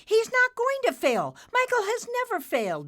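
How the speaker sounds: very high, loud, fast defence; defiant. Acted. Nimbus defends Michael to his father, high and defiant. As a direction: rush to defend the hero.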